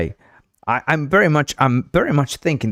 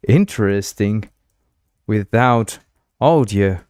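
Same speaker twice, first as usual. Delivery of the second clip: halting phrases with long gaps; word by word, as if typing it out; composing aloud; slower than usual. Real, not acted. Streamer composing a reply to Sarah in chat, slow and halting.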